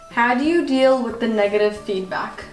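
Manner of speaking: normal talking voice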